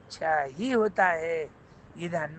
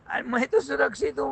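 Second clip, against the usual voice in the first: high, lively protest; emphatic retort; 8 semitones higher than usual. Acted. Jailed minister answering the doubting king, high emphatic retort. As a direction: answer back brightly, pitched well up.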